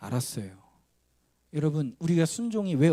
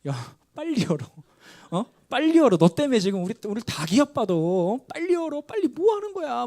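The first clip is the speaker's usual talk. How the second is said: high, loud frustrated urging; 8 semitones higher, 4 dB louder than usual. Retold or imitated. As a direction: shriek at him to just open the door, exasperated. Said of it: "너무 답답한 거예요".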